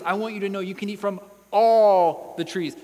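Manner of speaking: emphatic generosity, swelling on "all"; stress on ALL. Acted. Preacher voicing God's generous word, stressing "all" hard. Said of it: "God made a point to emphasize how much".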